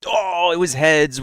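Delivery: high, loud surprised oh; sudden surprise at the coin. Real, not acted. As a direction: caught off guard by the flip.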